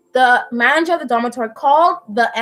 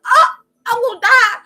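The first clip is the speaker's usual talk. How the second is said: high-pitched hammed-up panicked cry; mock panic; 11 semitones higher, 4 dB louder than usual. Imitating her scared friend fearing death, mock panic. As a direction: wail it like a frightened patient. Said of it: "she got scared".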